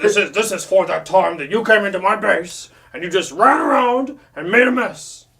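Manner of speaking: loud, hammed-up villain rant; mock angry villain. Acted Megatron taking revenge.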